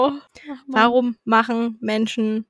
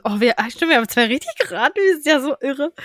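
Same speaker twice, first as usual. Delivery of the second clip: ordinary talk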